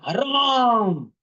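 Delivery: sharp, high angry exclamation; mock angry outburst; angry curse. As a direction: spit the curse out in anger. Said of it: "he became very angry".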